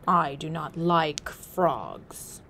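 slow, heavy chief's voice; a hint of a gruff chief, slowly; buffalo chief. Honors himself, buffalo chief.

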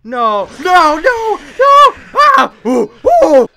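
high, loud cry of panic; terrified screaming. Real, not acted. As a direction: scream as it gets you. Jumpscare, crying shout.

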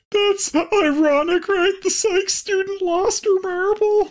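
squeaky joking falsetto; mock high voice. Joke remark, high put-on voice.

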